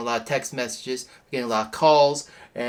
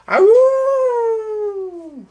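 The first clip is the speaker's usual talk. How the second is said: high, loud werewolf howl; wolf howl; 8 dB louder than usual. Acted.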